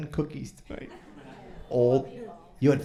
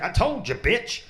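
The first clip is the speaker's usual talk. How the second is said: higher, louder angry rap parody; 8 semitones higher, 3 dB louder than usual. Retold or imitated. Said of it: "Every song is like".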